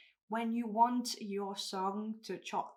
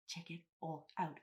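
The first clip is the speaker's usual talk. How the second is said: breathless, airy and winded; out of breath; 4 semitones lower, 6 dB quieter than usual. Real, not acted. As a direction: talk while catching your breath. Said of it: "I'm out of breath".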